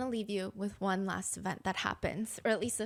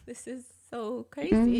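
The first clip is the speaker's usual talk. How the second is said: a louder, slow laugh of disbelief while speaking; disbelieving laugh; 7 dB louder than usual. Retold or imitated. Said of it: "Like, that kinda laugh".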